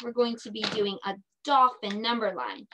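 ordinary talk

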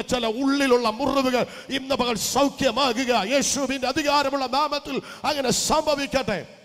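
loud, high proclaiming crescendo; loud pulpit proclamation of healing; preaching at full force. Acted. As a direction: build into a fiery healing declaration.

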